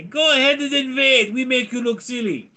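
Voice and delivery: put-on very high, strained comic Russian-accented taunt; mock-Russian accent. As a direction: dare them in a hammed-up Russian accent. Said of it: "Ukraine say".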